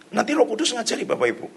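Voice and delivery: normal talking voice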